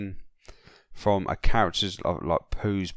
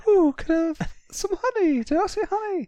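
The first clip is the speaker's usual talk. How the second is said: very high, put-on Pooh bear voice; 3 dB louder than usual. Friend imitating Pooh asking for honey, high put-on voice. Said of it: "it's just like".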